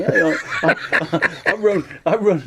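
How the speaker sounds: lightly voiced excited caller; stammering caller. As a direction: fake a nervous fan on the phone. Friend's put-on voice phoning in.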